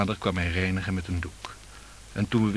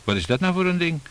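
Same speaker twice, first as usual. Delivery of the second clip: higher, puzzled exclamation; 8 semitones higher than usual. A narrator's reading. Husband lifts the knife rest at table, exclaiming in puzzlement. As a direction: blurt it out, pitched up. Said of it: "onder de uitroep".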